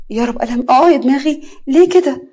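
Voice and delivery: loud, high cry of pain. Acted. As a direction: wail it out, rising to a peak. Hurt, cries out in pain.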